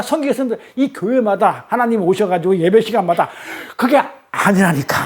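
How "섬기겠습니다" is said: mock repeated vow. Shown as a demonstration. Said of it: "우리 교회가 하나님을 그렇게 섬기겠습니다".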